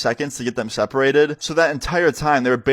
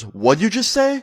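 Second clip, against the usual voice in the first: much higher-pitched, incredulous challenge; affronted; 9 semitones higher, slower than usual. Retold or imitated. Substitute to a student who cursed at her, affronted. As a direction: imitate an outraged teacher demanding a repeat.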